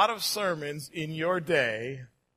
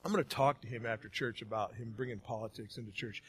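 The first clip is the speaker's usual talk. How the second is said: muttered inner thought; 7 semitones lower, 8 dB quieter than usual. Retold or imitated. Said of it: "you're thinking".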